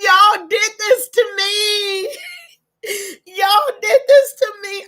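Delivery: real tearful sobbing; overcome, talking through tears; crying. Real, not acted. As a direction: blurt it out through sobs. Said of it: "I can't stop crying".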